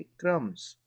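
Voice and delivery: क्रम्स said as krums, no b sound; silent b. Shown as a demonstration. Teacher showing the class: क्रम्स without the b. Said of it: "क्रम्ब्स नहीं कहेंगे".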